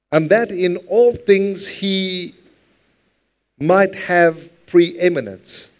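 slow, lifted scripture reading. Acted. Pastor reads the verse slowly to the congregation. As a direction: read it slowly, with weight.

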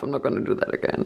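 fast whispered refusal; muttered refusal. Real, not acted. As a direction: mumble it under your breath.

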